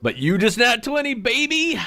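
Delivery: very high, squealing cheer; excited. Retold or imitated. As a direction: squeal it like a thrilled fan. GM cheering at the roll.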